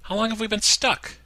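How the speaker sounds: put-on high, whiny girlfriend voice; impatient girlfriend stuck in traffic, voiced high; Gina, impatient. A narrator's reading. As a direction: complain in a high voice.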